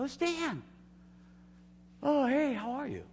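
high, bright phone hello; high delighted phone voice; cheery phone greeting. Retold or imitated.

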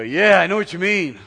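eager gossip agreement. Retold or imitated. Preacher acts someone joining gossip, loud and high. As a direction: jump in loud and keen to join the gossip. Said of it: "jump in there and go".

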